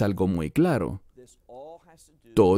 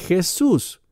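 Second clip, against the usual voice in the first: Jesús said high and clear; saying the name plainly; 8 semitones higher than usual. Shown as a demonstration. Teacher says Jesús to show he has no shame. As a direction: say Jesús high, without hesitation. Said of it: "ni dudo en mencionar ese nombre".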